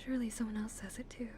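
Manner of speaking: ordinary talk, nothing marked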